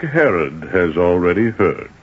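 slow, low pronouncement; ominous reveal. Acted. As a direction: let the threat sink in. Lord reveals that Herod knows.

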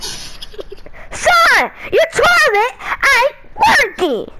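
high, slow immigrant dad voice. Acted. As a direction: play the clueless dad, high and drawn out. Dad voice repeated, laughing.

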